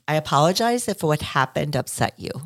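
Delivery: lightly voiced insincere apology; mock hollow apology. Host mimicking a hollow apology, lightly insincere. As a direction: say it smoothly, not really meaning it. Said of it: "That's not taking responsibility".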